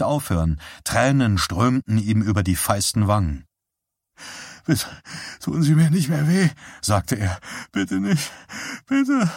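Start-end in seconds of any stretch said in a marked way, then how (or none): 4.7-9.3 higher, tearful begging; pleading while starting to cry; weeping plea. A narrator's reading. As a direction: beg through weak sobs, voice cracking upward. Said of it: "begann schwach zu weinen".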